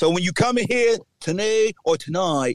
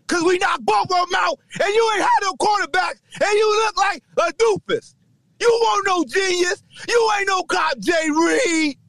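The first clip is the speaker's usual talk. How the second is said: shouting rant; 9 semitones higher than usual. Real, not acted. Shouting down the rival defensive coach after the win. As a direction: bark it at the rival like a victory taunt.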